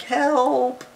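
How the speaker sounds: high, loud cry for help; exclaimed. Shown as a demonstration. Showing a child an exclamation, exclaimed. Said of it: "someone's exclaiming something like".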